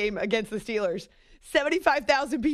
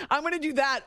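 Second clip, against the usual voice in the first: ordinary talk, nothing marked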